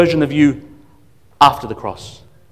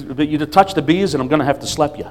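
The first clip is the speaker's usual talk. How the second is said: ordinary talk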